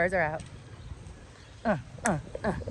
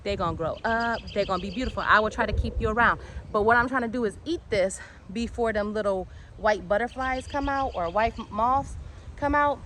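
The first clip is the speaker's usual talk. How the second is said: ordinary talk, nothing marked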